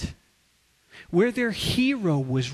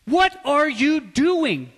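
sharp, loud and higher, slowly; angry rebuke; 7 semitones higher, 6 dB louder, slower than usual. Retold or imitated. Jesus scolding the disciples who block children, furious. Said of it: "He was angry".

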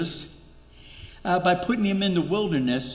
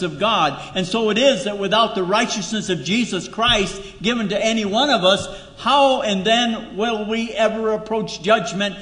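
louder, building sermon climax; full-force preaching; 4 semitones higher, 5 dB louder than usual. Acted. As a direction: preach it hard to the congregation.

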